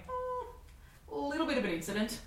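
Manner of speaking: high, guilty little hum then admission; sheepish confession. Retold or imitated. Owning up to mates at the pub, high and sheepish. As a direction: own up like a caught kid.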